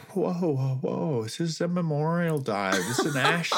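high-pitched, incredulous pushback; retold protest. Jumper calming the pilot on a memorial dive, high protest.

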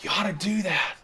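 a hushed gentle nudge; whisper-like prompting from inside; the inner voice urging a decision, soft. Acted. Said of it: "And it say".

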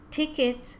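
high, singsong door lady; short, high-pitched call; chirping. A narrator's reading. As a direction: chirp it like a cheery attendant. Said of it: "chirped the lady at the door".

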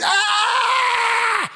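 put-on long, high baby wail; high-pitched mock infant cry; imitated baby scream. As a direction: mimic a baby screaming in the night. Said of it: "screaming at the top of their lungs".

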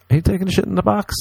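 quick squeaky incredulous ask; high fast mock question. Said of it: "I'll say".

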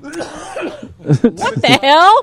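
stretched-out, laughing disbelief; surprised. Real, not acted. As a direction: drag it out, half laughing. Reacting to a cough on mic.

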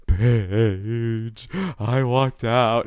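high, slow wail; crying. Retold or imitated. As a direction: wail it out like a drunk crier. Drunk crying to roommate.

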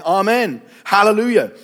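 ringing liturgical acclamation; worshipful cry. A narrator's reading. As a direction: proclaim it high and strong in worship. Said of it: "fell down and worshipped God".

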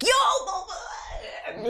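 high, laughing "Yo!" shout; playful yell. Retold or imitated. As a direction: call out with a laugh. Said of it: "I'm like".

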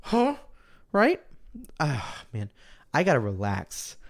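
ordinary delivery

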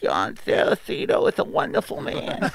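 muffled, high-pitched boxer impression; Mike Tyson. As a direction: talk high, as if buried in someone's chest. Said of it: "You hear muffled. You hear, just hear".

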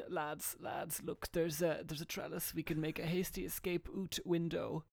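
softly urgent whisper to the lads; hushed urgent voice. Acted. Character whispers escape plan.

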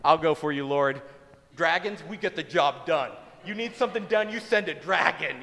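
loud, gung-ho dragon boast; volunteer at full volume like a cocky dragon; booming mock-heroic bravado; eager dragon volunteer. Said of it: "one of those dragons would be like".

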